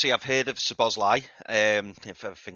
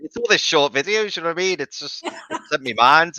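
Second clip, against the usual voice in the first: laughing; 8 semitones higher, 8 dB louder than usual. Real, not acted. Laughing after being teased.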